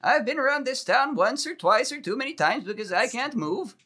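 put-on high, creaky old geezer voice; decrepit old man. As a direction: play a doddering old man. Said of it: "it's the decrepit old man".

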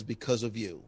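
low, soft and sincere; heartfelt thanks. Shown as a demonstration. A listener thanking the host, quiet and sincere. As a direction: say it like genuine gratitude. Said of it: "as long as it's a positive".

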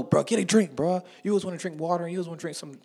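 high, loud imitation of nagging friends; pestering friends. Friends pestering him to drink, voiced.

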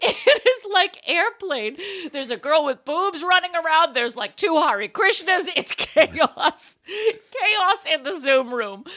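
giggly, slow, high and loud through laughter; laughing retelling. Retold or imitated. Telling Jules about court, laughing hard. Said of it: "I said".

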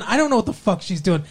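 ordinary talk, nothing marked

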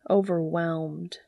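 usual speaking voice

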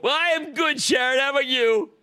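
high, hollered hello; exuberant greeting. Real, not acted. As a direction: greet the caller with big showman energy. Hollers greeting back to caller.

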